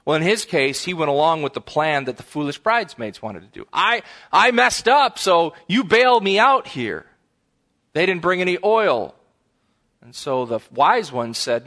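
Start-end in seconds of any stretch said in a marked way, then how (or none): 3.7-7.1 higher, louder, slow begging voice; a careless bridesmaid begging to be bailed out; whiny plea. Retold or imitated. Foolish bridesmaid slowly begging her friends for help.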